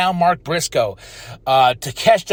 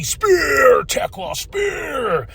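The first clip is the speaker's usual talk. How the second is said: high, drawn-out "spear" yell; mock battle cry; 10 semitones higher, much slower than usual. Host acting out Tekla spearing everybody, high mock battle cry. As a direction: mimic a wrestling spear call, high and stretched. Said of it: "spearing everybody".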